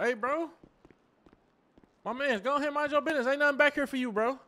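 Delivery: dismissive loud brush-off; shooing someone off. Acted. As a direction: wave him off with your voice. Shooing a bystander away.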